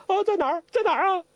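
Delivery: high startled yelp of alarm; startled old imp. Acted. Zhou Botong panics at hearing of the lady, startled. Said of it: "周伯通更惊了".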